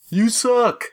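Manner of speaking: high mocking heckle from the crowd; heckler. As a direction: shout it up from the back row. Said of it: "heckler voice in retold crowd".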